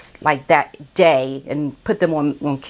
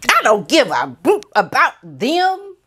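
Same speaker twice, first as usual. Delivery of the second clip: higher, mocking replay of the man's angry outburst; retold snap; 6 semitones higher than usual. Vlogger replaying the man snapping at her, higher mock anger. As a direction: snap it back the way he did. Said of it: "he snapped".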